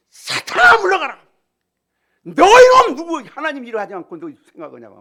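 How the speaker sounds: very high, loud scolding of Satan; Jesus rebuking Peter sharply; stern rebuke. Acted. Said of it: "야단을 친 거야". (베드로를 꾸짖는 예수님의 호통)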